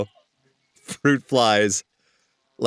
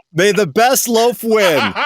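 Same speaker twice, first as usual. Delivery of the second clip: slow, higher and louder, ceremonial; grand proclamation; 7 semitones higher, 5 dB louder, slower than usual. Acted. Host declaring a winner, slow grand proclamation. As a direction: announce it like a royal toast.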